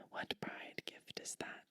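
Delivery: ordinary delivery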